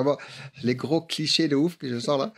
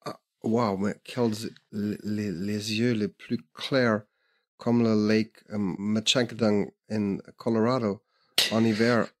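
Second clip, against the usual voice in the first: put-on French spoken lower with a loose American accent; 3 semitones lower than usual. Smitten American inner monologue, in a lower American accent. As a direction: drawl it like a lovestruck American. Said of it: "Ok, je fais un américain".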